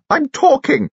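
high-pitched indignant insistence; shouted. Acted. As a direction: demand the floor in a high voice. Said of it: "shouted Lucy Lorimer".

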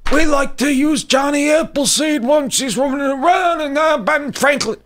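high-pitched mocking caricature of a comedian; Colin Quinn. Host parodying the comedian's history material, mocking high voice. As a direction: mimic him in an exaggerated high voice. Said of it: "He goes".

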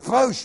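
sudden loud, high "boosh"; imitated whoosh. Preacher acts out people being raptured, sudden whoosh. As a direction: punch out a vanishing sound effect.

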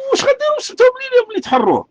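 very high-pitched, exasperated rhetorical question; incredulous question. Real, not acted. Ranting citizen asking what liberating the city would achieve, incredulous. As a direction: shoot the pitch way up in disbelief, then drop.

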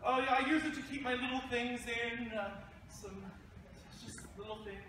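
high voice tailing off softly; stagey awkward excuse, pitched high and trailing off; nervous cover-up. Acted. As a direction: bluff it in a high voice, then fade away. Play excerpt, awkward cover story.

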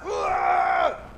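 wordless rushing wind noise; imitated wind gust. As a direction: blow in like a closing gust. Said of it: "y se acerca más".

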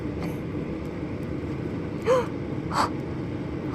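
a long, high, breathless gasp of delight. Real, not acted. Fan spots the card she wanted, long high gasp. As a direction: gasp in at the sight of it.

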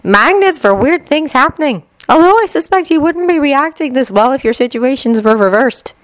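put-on a young mother, wry and tired; Winry, wry. A narrator's reading. Winry voiced in story.

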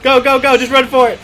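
loud urgent yelling; urgent shout. Real, not acted. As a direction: yell at them to run. Urging teammates to flee.

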